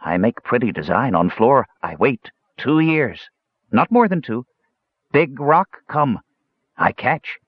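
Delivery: halting, simple-minded storyteller; gully dwarf. Acted. Gully dwarf explaining how he caught the rock, simple. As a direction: plod through it in blunt little phrases.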